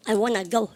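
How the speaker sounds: relaxed American wanna; casual wanna. Shown as a demonstration. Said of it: "이렇게 얘기하죠".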